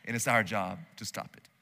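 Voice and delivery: calm the rookie down, low and quiet; Tommy Lee Jones calm. Retold or imitated. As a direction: veteran agent reassuring his partner, low and unhurried. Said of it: "Tommy Lee Jones says".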